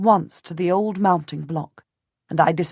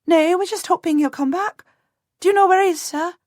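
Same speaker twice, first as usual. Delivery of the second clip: put-on high, quick voice of a flustered peasant girl; a startled servant girl piping up to a lord; 12 semitones higher, 5 dB louder than usual.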